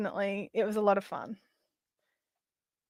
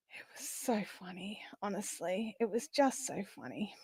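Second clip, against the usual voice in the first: whispering; 3 semitones lower, 15 dB quieter than usual. Real, not acted. Hushed aside while laughing.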